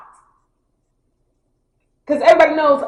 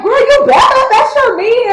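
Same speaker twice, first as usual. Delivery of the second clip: much higher and louder, egging her on; hyped friends; 12 semitones higher, 7 dB louder than usual. Retold or imitated. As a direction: push her to go like excited girlfriends. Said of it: "And they like".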